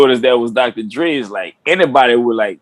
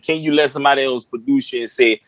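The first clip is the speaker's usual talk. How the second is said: normal talking voice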